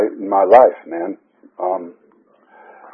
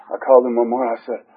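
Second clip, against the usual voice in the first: ordinary delivery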